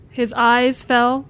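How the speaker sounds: ordinary talk, nothing marked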